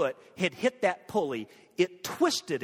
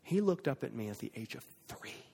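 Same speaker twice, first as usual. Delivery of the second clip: normal talking voice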